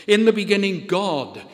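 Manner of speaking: each word of the refrain landed firmly; emphatic refrain. Acted. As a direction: declare it like a creed. Refrain repeated with rising force.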